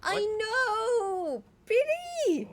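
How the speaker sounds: high, loud excited outburst; excited exclaim. Real, not acted. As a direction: burst out, delighted. Exclaims at game event.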